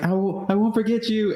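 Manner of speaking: high mock plea, imitating; squeaky mock-earnest farewell; quoting Pedro. Pedro hoisting him over the wall, high mock plea. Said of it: "and he's like".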